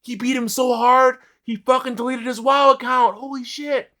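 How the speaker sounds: high, loud mock astonishment; telling onlookers the loser quit in shock; amazed exclamation. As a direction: gush in disbelief to the crowd. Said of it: "And I said".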